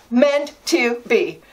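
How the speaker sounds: slow, savoring echo. Real, not acted. Repeating it slowly to herself, savoring. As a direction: linger over every word.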